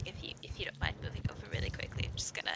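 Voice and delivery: fast hushed whisper. Real, not acted. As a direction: whisper it quickly aside. Whispered aside to Joe.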